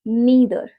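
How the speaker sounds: "neither" as NEE-ther; long ee in "neither"; American pronunciation. Shown as a demonstration. Teacher models the last word, American pronunciation. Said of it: "American pronunciation".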